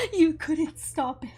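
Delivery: ordinary delivery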